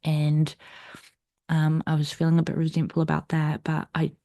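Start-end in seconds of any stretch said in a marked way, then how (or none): none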